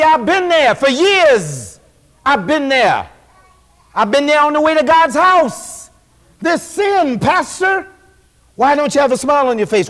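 preaching at full force. Acted. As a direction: call it out over the congregation.

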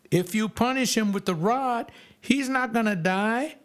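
high, driving preacher's exhortation; preaching. Acted. Preacher urging parents not to hold back, high and driving. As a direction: preach it hard to the congregation.

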